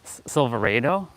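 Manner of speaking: Silverado said "silver-AY-do", as a question; questioning Silverado, rhyming with tornado; unfamiliar pronunciation. Retold or imitated. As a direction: try out Silverado with a long AY, rising. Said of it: "Never heard it sa-, said like that before".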